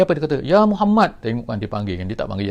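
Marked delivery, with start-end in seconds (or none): 0.4-1.2 high, loud call from a rough Bedouin. Retold or imitated. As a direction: shout his name out high and loud. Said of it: "panggil dengan suara tinggi". (panggilan kuat dan tinggi)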